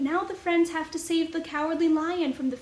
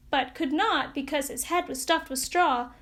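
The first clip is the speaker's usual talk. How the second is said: ordinary delivery, nothing marked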